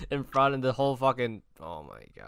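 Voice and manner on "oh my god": normal talking voice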